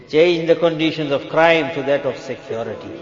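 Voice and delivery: normal talking voice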